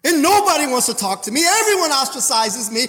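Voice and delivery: acted-out lament of a struggling believer; high-pitched, building complaint; distressed complaint.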